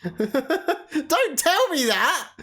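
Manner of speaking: slow, laughing yell of protest; laughing slow protest at chat. Real, not acted. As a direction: drag it out, laughing, begging no spoilers. Reacting to spoiler in chat.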